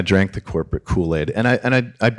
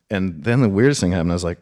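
usual speaking voice

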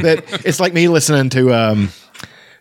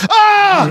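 high excited shout of joy; excited yell; 4 dB louder than usual. Retold or imitated. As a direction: yell "Ah!" in delight. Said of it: "and we were all like".

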